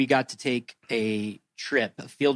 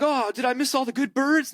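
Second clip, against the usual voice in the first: high-pitched dismayed student; put-on high voice, crestfallen question; hapless latecomer; 11 semitones higher than usual. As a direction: play the kid who arrived too late.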